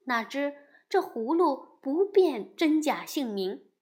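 ordinary delivery, nothing marked

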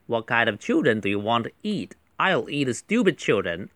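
quick model reading with sentences run together; fast read-through. Shown as a demonstration. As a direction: rattle through the dialogue in one breath. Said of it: "我快速读一次". (一口气快速读完)

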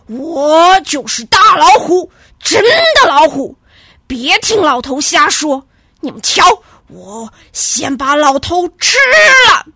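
loud, menacing threat; fierce tiger. A narrator's reading. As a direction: snarl that you will eat the old man. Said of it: "大老虎却凶狠狠地说".

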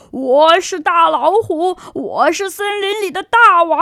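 loud swaggering tiger shout; boastful roar. Acted. Tiger brags to the forest, loud and boastful. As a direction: bellow it like a proud bully. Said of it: "大呼大叫".

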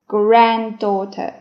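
normal talking voice

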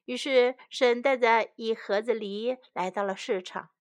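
usual speaking voice

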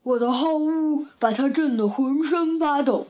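mild bear-like bellow; lightly roared bragging bear; roaring bear. A narrator's reading. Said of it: "熊咆哮道".

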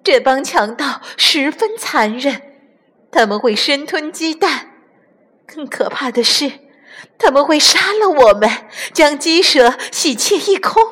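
high, anxious warning; tense, breathless fear. A narrator's reading. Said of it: "紧张得透不过气来".

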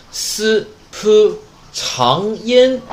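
slow and broken apart, "s", "p", then "长音"; sound-by-sound demonstration. Shown as a demonstration. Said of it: "这个单词要注意发音".

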